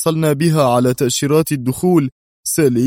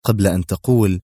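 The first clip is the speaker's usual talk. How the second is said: plain reading voice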